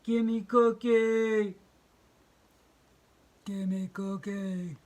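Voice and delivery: slow, loud monster grunt; slow gruff gimme cookie; demanding monster. Acted.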